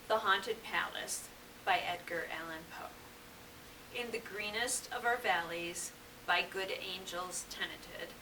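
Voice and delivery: low, soft, measured verse recital; hushed, stately poem voice; poetry reading. Acted. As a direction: read it gently, pausing at line breaks. Reads Poe poem aloud.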